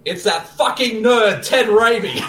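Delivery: mock outraged announcement.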